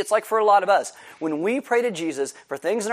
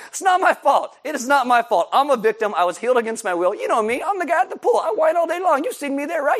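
hammed-up whiner blaming others; whiny victim; 8 semitones higher than usual. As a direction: plead innocence like a sulky child. Healed man whines excuses.

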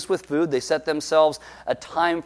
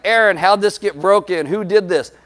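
a louder, raised parent demanding answers; accusing parent; 3 semitones higher, 7 dB louder than usual. Retold or imitated. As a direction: confront the kid like a suspicious parent. Parent voiced in childhood story.

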